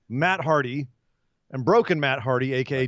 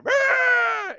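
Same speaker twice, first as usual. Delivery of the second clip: high shouted rah; mock battle roar. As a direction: roar it like a wrestler leaning out of a cart. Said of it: "Kenny leaning out and going".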